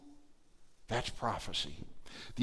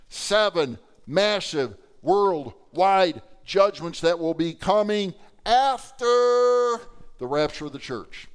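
ordinary talk, nothing marked

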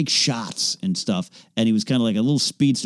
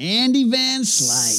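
drawn-out admiring; 10 semitones higher, much slower than usual. Acted. Savoring player name.

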